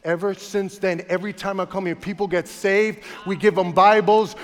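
preaching build. Acted. Pastor telling the congregation about street ministry, building fervour.

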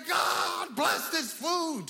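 hammed-up, high-pitched shouted grace; exaggerated shouted prayer. Preacher mocking an overblown grace before a meal, shouting. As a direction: bellow a simple grace far too intensely. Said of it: "Some people say they don't pray out loud".

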